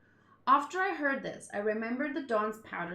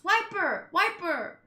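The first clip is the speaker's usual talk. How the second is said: higher, loud call of a name; 7 semitones higher, 4 dB louder, slower than usual. Acted. A character shouting a fallen comrade's name across the wreckage. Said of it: "she shouts".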